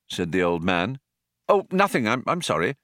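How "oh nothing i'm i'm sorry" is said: embarrassed. A narrator's reading. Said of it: "said Arthur in embarrassment".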